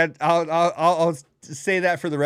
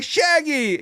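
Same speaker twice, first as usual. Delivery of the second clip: high excited call of his name; excited shout; 11 semitones higher than usual. Retold or imitated. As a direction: call out to him, thrilled. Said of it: "you're like".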